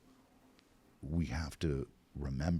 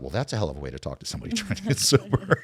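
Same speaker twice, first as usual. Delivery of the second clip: laughing disbelief; 6 semitones higher, 7 dB louder than usual. Retold or imitated. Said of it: "And I thought".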